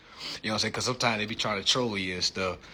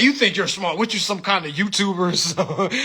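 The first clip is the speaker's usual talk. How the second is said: higher and louder, a taunting officer; mock cop taunt; 6 semitones higher, 3 dB louder than usual. Officer mocking the driver, taunting. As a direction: needle him like a doubtful cop. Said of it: "they be like".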